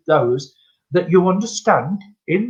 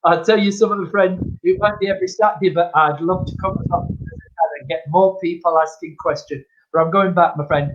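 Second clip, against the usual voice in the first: normal talking voice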